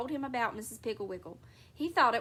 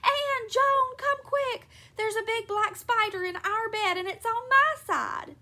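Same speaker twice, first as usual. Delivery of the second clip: high, loud squeal of alarm; panicked squealing; 10 semitones higher, 6 dB louder than usual. A narrator's reading. Mother calling her daughters to a spider, squealing. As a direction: squeal it out in a fright. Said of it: "clutching her bathrobe and squealing".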